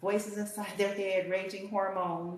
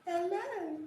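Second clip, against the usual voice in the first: higher sugary mock-innocent voice; fake sweet girl; 10 semitones higher than usual. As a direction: play a syrupy fake sweetheart, mocking her. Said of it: "They say".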